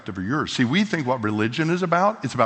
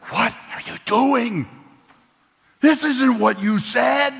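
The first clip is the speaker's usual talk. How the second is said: Moses complaining; 10 semitones higher, slower than usual. Retold or imitated. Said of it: "Moses goes to God and says".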